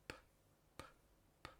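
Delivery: p sorda, solo aire, sin voz; sonido p sordo. Shown as a demonstration. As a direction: suelta la p con un soplo de aire. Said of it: "El primero es sordo". (p sorda aislada, sin vibración)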